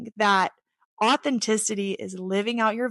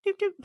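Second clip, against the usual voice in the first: higher playful horn toots; 13 semitones higher than usual. Pretend. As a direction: toot your own horn with a little doot doot. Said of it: "I'm not tooting my own horn".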